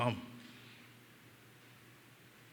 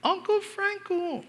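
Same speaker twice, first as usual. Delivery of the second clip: very high, drawn-out, distant little call; thin high child's voice calling slowly; child calling from afar; slower than usual. Retold or imitated. Niece calling her uncle across a busy mall, high faraway call. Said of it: "from a distance, I hear".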